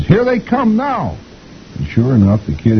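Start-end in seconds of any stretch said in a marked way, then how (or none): 0.0-1.2 put-on very high, drawn-out kid call; excited kid. A narrator's reading. Kid spots the parakeet arriving, high drawn-out call. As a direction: announce it like an eager child. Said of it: "one of the kids says".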